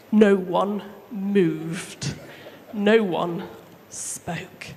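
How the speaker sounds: slow, dropped to a hush; hushed suspense. A narrator's reading. Storyteller to children, slow hushed suspense. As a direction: let the silence hang. Dramatic pause narration.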